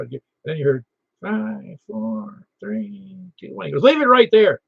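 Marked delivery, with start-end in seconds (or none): 1.2-3.7 high, faint imitation countdown; thin-volume, raised voice mimicking a distant caller; far from the mic. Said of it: "put it out full arm's length". 3.8-4.6 high, loud quoted command; emphatic mock radio instruction; punchline shout. Punchline of the mic test story.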